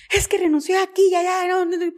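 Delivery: mock tearful, high-pitched complaint; imitación de llanto. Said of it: "Llorando, le digo". (imitando llanto con voz aguda)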